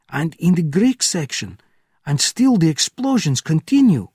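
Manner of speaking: high, loud and agitated; frantic report. Acted. Gate officer frantically telling Benjamin of the fires, high and loud. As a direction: pour out the news in a panic.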